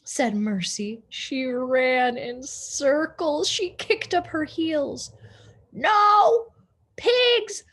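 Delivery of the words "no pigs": high, loud outraged yell; angry shout. A narrator's reading. Eugenia yells at the pig to get out, outraged. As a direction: bellow the rule at the pig. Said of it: "Eugenia shouted".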